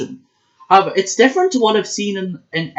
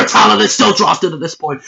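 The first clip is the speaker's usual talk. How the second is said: loud coughed taunt; mock cough with a cheeky dig; jab disguised as a cough; 3 semitones higher, 4 dB louder than usual. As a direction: slip a dig at the rival inside a cough. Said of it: "I am so sorry".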